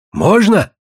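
high, hopeful question; light up with sudden hope; old man's pleased surprise; delighted. A narrator's reading. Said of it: "обрадовался старик".

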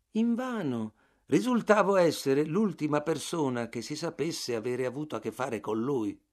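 ordinary delivery

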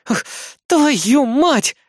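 high, loud angry curse; furious cry of a girl caught naked; angry growl. Acted. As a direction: snarl it out in anger. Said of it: "злобно прорычала Мариарти-сан".

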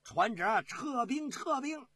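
high-pitched panicked order, rising on the repeat; loud cry. Acted. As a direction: scream the order to retreat. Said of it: "大叫一声". (高声惊叫下令)

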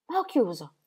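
breve e netta, un po' più alta; decisione finale. Acted. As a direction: chiude il discorso con fermezza. (la moglie dichiara al marito di aver chiuso)

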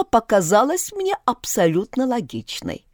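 plain reading voice

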